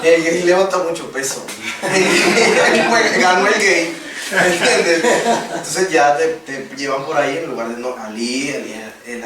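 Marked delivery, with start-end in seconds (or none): 0.0-5.0 laughing. Real, not acted. Mimics gym talk about him.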